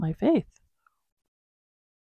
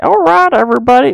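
All right, everybody pitched much higher and louder in a silly put-on accent; funny accent; 7 semitones higher, 12 dB louder, faster than usual. Mimicking her own sign-off greeting, much higher and louder funny accent. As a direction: belt the greeting in a goofy accent. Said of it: "with a funny accent".

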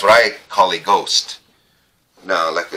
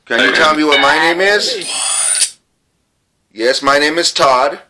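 raised voice addressing spirits; calling out to spirits; 10 semitones higher than usual. Real, not acted. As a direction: call out to the ghosts, projecting.

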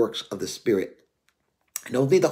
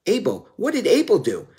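ordinary delivery

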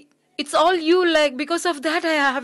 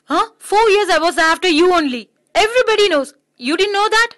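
loud, high, hurt outburst; shouting accusation at her friend; angry shouting; 4 semitones higher, 6 dB louder than usual. Acted. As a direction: throw it at him, wounded and furious. Heated confrontation, shouting at him.

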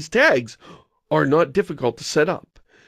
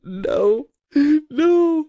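high, wailing mock cry; hammed-up wail of despair, climbing higher; mock anguish; 3 dB louder than usual. Host caught out by the Frog Fractions question, mock anguish.

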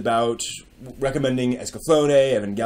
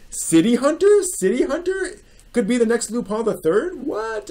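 incredulous; 10 semitones higher than usual. Real, not acted. Incredulous at old prediction.

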